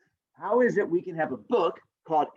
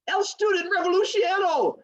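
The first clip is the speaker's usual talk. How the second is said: high dramatic mock Spanish flourish; 4 dB louder than usual. Teacher joking about student revolution, mock Spanish flourish. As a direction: roll it out like a fake Spanish slogan.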